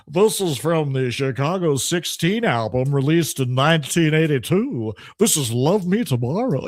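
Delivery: put-on announcer reciting album trivia; mock DJ voice. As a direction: parody a tedious radio DJ, running the words together.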